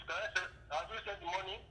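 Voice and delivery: ordinary talk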